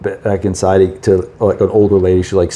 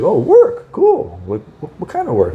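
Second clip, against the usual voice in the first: surprised lady at the bar; 6 semitones higher than usual. Retold or imitated. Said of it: "She's like".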